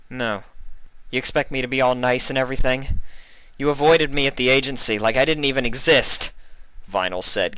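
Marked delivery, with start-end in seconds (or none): 0.1-6.3 rising from firm to loud and high; growing anger. A narrator's reading. Vinyl turns on Mully, anger building. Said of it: "getting angry".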